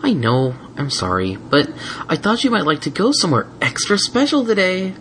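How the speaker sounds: lightly voiced sweet Twilight; gentle mare voice.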